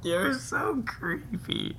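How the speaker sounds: high, slow mocking voice; creepy put-on voice. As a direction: say it in a strange high drawn-out voice. Teasing partner in odd voice.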